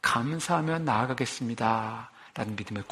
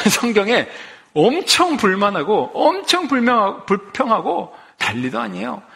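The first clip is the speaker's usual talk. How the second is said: amused exclamation; 6 semitones higher, 5 dB louder than usual. Real, not acted. Laughing exaggeration of complaints.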